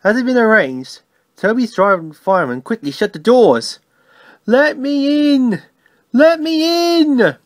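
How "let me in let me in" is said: high, drawn-out ghostly wail; spooky voice. A narrator's reading. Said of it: "said Percy in a spooky voice".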